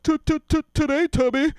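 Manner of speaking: high, loud stuttering taunt; Adam Sandler stammer. Retold or imitated. Old classmate mocking him with a goofy stutter. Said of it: "pull the Adam Sandler".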